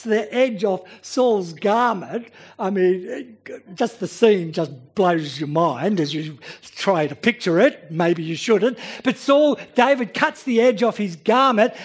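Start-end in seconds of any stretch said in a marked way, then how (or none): none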